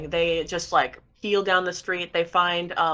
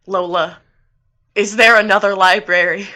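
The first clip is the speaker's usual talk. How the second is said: raised-voice plea to a helper; louder acted appeal from outside; calling out; 8 dB louder than usual. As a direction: call the question out loudly. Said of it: "stands outside and they're like".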